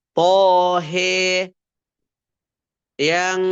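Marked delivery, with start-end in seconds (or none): 0.1-1.5 "Tohe" drawn out, last a tilted to "e"; louder "Tohe", final vowel bent to e; imalah. Shown as a demonstration. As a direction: recite "Tohe" long, with heavy "to" and long "he". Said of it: "membaca imalah pada huruf ha".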